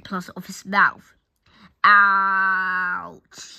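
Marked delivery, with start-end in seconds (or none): 1.8-3.6 long, loud, drawn-out "ow"; cried out. A narrator's reading. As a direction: yelp it out and stretch the pain. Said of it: "he cried".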